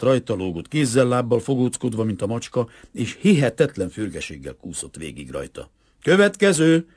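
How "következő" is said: loud, high call for the next one; bellowed order. A narrator's reading. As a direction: yell it as a command. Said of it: "ordította Lubovszki".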